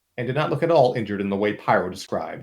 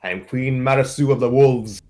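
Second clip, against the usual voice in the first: louder put-on voice, grandly announced; proud queen; 4 semitones higher, 6 dB louder than usual. The queen introducing herself to the mercenaries, louder put-on voice. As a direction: declare your royal title. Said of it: "I dare you Southerners".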